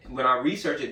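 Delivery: ordinary delivery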